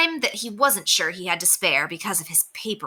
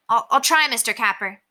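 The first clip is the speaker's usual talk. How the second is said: unsure promise; 4 semitones higher than usual. Acted. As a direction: stammer it, unsure. Henry reluctantly promises.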